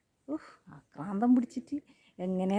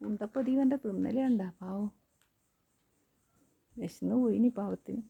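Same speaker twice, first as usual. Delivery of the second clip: lower, softer pitying murmur; 5 semitones lower, 6 dB quieter than usual. Real, not acted. Pitying the hungry animal eating, soft tender. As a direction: feel sorry for the hungry one.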